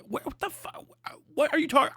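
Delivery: breathless, whispered high disbelief; an airy, incredulous whisper; mock disbelief. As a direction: gasp it out in mock shock. Mock reaction to a claimed syndrome.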